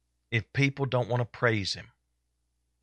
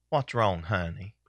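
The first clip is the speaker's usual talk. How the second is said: the devil comforting; 5 semitones lower than usual. Acted. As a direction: coax like a tempter at a pity party. Preacher voices the devil consoling.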